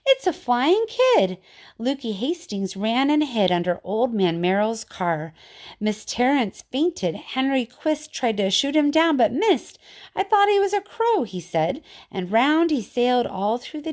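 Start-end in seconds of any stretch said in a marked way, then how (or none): 0.1-1.4 higher, louder slow cry of amazement; screamed. A narrator's reading. Jenny Ricks seeing the flying boy, screaming. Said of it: "dropped her knitting and screamed". 10.2-11.2 high, loud sheepish excuse. A narrator's reading. Henry Quist explaining his missed shot, sheepish.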